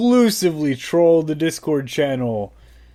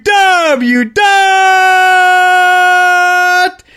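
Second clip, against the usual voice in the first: drawn-out high shout; 12 semitones higher, 5 dB louder, much slower than usual. Real, not acted. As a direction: yell it across the room. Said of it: "I didn't tell you to shout".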